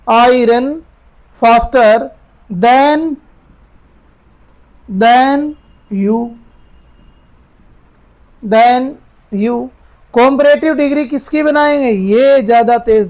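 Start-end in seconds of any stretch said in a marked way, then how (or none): 0.1-9.6 slow pieces with long pauses, than repeated; slow piecemeal dictation; word by word. Shown as a demonstration. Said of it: "fast की comparative बना दो बेटा".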